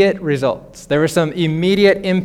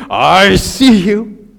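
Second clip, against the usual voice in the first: slow, louder, pointed "I see you"; stomping accuser; 6 dB louder, much slower than usual. Retold or imitated. God imagined stomping in on Adam, slow accusing call.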